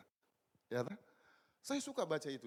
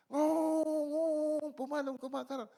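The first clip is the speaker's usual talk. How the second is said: a lightly voiced child, higher and urgent; excited child; 12 semitones higher, 6 dB louder, slower than usual. Son running to his father about the red report card, lightly voiced urgent child. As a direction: call out like a breathless little kid.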